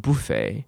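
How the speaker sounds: "buffet" as BOO-fay, front stressed; British pronunciation. Shown as a demonstration. Teacher showing how British people say it, "buffet" as BOO-fay. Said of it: "那英国人是念成".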